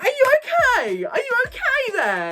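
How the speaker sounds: high, sing-song mock check-in; a high teasing imitation; high mock concern. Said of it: "Just like".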